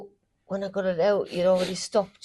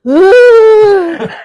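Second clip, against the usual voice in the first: loud high scream; sleep screaming; 14 semitones higher, 22 dB louder than usual. Retold or imitated. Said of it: "There was a little bit screaming".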